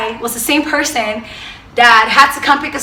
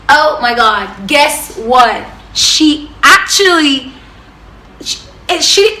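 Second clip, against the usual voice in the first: ordinary talk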